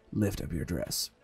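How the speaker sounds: low, hushed request; quiet murmur. A narrator's reading. Colton privately asking Annie before treating her stitches, quiet murmur. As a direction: drop pitch and volume, keep it private. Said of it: "I whispered quietly to her".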